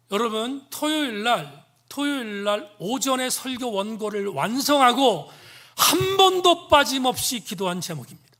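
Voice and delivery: loud, high sermon delivery; forceful preaching. Real, not acted. Preacher raises voice to congregation.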